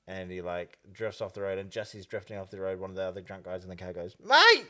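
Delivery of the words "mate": high, loud yell of "Mate!"; alarmed shout. Retold or imitated. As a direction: drunk passenger yelling a warning at the driver. Drunk passenger alarmed as car drifts.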